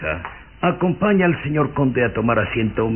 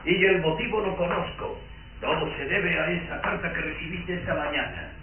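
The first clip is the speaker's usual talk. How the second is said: knowing murmur; 4 semitones higher, 9 dB quieter than usual. Acted. Father quietly exposes his daughter's letter in a knowing murmur. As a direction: drop to a soft, pointed undertone.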